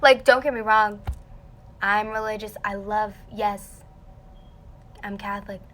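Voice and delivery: ordinary talk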